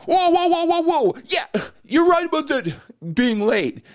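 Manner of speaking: high, loud "whoa whoa" of a listener cutting in; a startled listener protesting, pitched high; mock alarmed objection. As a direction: play the listener who jumps in to object. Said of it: "if you're going right now".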